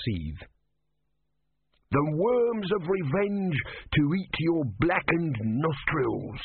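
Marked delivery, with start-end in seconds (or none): 1.9-6.4 high, slow, strange furious curse; screaming tortoise god. Acted. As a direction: spit a curse like a tiny god. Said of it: "screamed Om".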